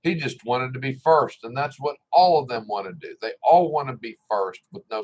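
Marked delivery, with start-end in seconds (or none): none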